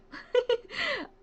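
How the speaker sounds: very high-pitched little laugh; high giggle. Real, not acted. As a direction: giggle up high after teasing. Laughs after joking.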